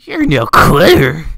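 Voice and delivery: put-on old man yelling at full blast; very loud, drawn-out old man shout. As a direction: bellow it in a hammed-up old man voice. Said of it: "I'll do the old man voice".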